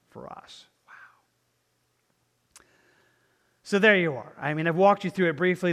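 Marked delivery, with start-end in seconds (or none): 0.1-2.6 hushed, breath-only whisper; awed whisper. Real, not acted. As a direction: drop to a whisper in awe. Preacher awed by the cross.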